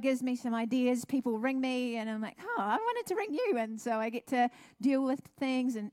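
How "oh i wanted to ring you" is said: high, pleasantly surprised; delighted surprise. Retold or imitated. [name] surprised by a caller she meant to phone. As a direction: sound amazed they called.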